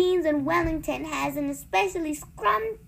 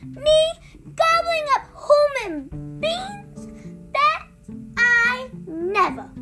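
high, loud, drawn-out indignation; mighty shout; 8 dB louder, slower than usual. A narrator's reading. Giant denying he eats people, shouting. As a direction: bellow in offended disbelief, pausing for effect. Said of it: "shouted the giant, his mighty voice".

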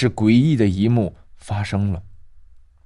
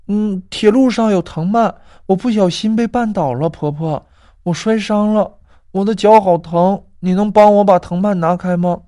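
put-on high, pained girl's voice; a little girl wincing, asking for help; hurt young girl; 8 semitones higher, 4 dB louder than usual. Tripped girl asking grandma to free her foot, high and pained.

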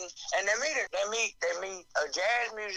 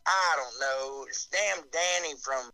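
ordinary talk, nothing marked

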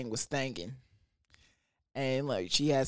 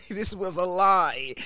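higher, louder, slower repeat of lie; mock outrage; 6 semitones higher, 4 dB louder than usual. Shown as a demonstration. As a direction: hammer the word lie in disbelief.

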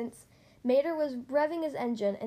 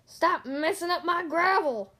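loud, higher-pitched angry bulldozer; angry yell; 4 semitones higher, 5 dB louder than usual. A narrator's reading. Bulldozer to two friends in his pit, angry yell. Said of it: "he yelled".

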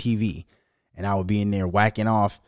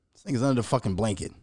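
ordinary delivery

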